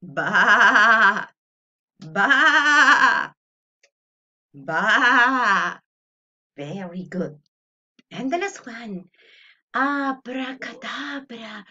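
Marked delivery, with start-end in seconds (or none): 0.0-5.8 loud bleating baa like a goat; bleating goat for the class. Shown as a demonstration. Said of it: "be a goat".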